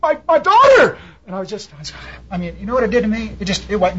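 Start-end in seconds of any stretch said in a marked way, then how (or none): 0.0-1.0 high, loud and desperate; a father's frantic plea on the phone; panicked cry. Retold or imitated. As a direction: cry it out like a father whose child is gone. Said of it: "He says".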